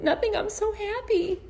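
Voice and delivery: high-pitched mock teary reply to her husband; teary happy reply. Telling husband David she's happy while crying, high teary voice. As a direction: pitch it way up, half crying with joy. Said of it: "And I said".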